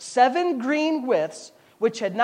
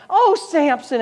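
lightly voiced sighing temptress; mock Delilah; 5 semitones higher, 5 dB louder, slower than usual. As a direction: sigh his name sweetly. Delilah sighing his name.

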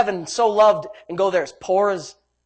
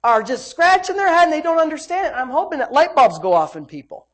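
loud, raised preacher's delivery; forceful preaching; 7 semitones higher, 6 dB louder than usual. Real, not acted. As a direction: drive it home to the congregation.

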